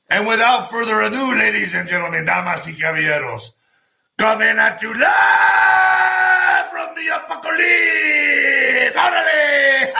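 high, slow booming ring intro; lucha libre announcer. Acted. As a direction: announce the main event like a wrestling show.

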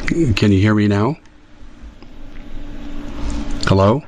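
patchy phone line; checking a cutting-out call; call dropping out. Real, not acted. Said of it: "I just totally cut out here".

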